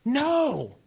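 high defiant no with a laugh; laughing mock yell. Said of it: "And so I yelled".

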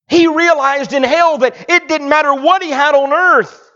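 high, loud preacher's sermon; fiery preaching. Acted. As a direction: preach it at full force to the pews. Preaching at full force.

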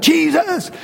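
high-pitched imitated cry of the lepers; acted plea hailed from a distance. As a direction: call out for mercy, pitched well up. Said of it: "they start crying out".

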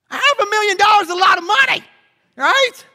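high, loud, fast outburst; exclaiming. Acted. Preacher marvels at the size of the gift, exclaiming. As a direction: blurt it out loudly, high and quick.

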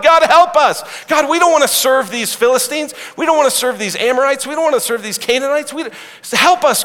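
loud, high cry for help to God; desperate plea. Acted. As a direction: beg God at full voice. Israel crying out to God.

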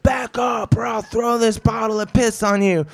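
high-pitched rushed shout, no pauses; all-at-once threat. Retold or imitated. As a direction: fire off the whole threat in one go. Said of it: "he says it all at once".

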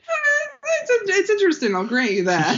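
put-on high whiny drunken wail; slurred, whimpering like a drunk kid; drunk child. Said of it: "You sound like a drunk child".

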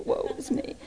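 whimpered whisper of self-pity; pitiful whispered mock moan; whispered mock lament. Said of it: "mocking whiny churchgoer".

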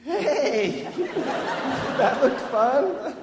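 put-on high, bright laughing hello to the kid; breezy and amused, laughing through it; laughing cheerful greeting. Catcher greets the rescued kid, laughing and high. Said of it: "and I'm like".

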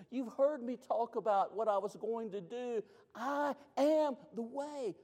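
high, pleading voice of Jesus; Jesus speaking to Thomas. Acted. Jesus reminding Thomas of all they shared, pleading. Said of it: "what Jesus is saying".

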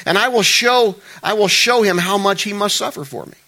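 loud, high proclamation of suffering; forceful declaration. Acted. Preacher voicing the Lord declaring Saul's suffering, loud. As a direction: declare it with weight.

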